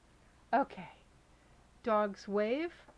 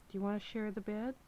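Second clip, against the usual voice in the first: ordinary delivery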